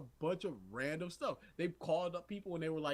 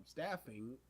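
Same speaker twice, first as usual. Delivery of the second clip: ordinary talk, nothing marked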